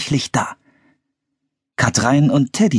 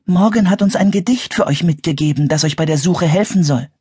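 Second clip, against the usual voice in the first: put-on higher-pitched helpful young wizard; Katrein, explaining; 9 semitones higher than usual. A narrator's reading. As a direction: explain the gift in a lifted voice. (erhöhte, erklärende Stimme)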